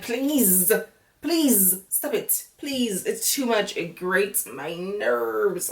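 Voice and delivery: worn out by all the crying; fed up. Real, not acted. Host tired of contestants crying, soft fed-up pleading. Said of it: "it just grates my nerves".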